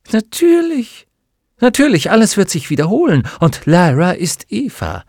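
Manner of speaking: loud, high, elated outburst; ringing, excited realisation; exultant revelation. Acted. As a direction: cry it out in sudden triumph. Villain exults at her discovery.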